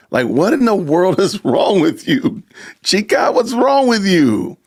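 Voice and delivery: incredulous. Real, not acted. Mocking dragon comment.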